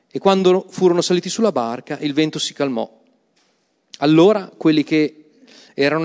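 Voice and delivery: measured, solemn Bible reading; proclaiming the passage aloud; scripture reading. Acted. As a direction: read the Gospel to the congregation with weight. Reads the Gospel passage aloud.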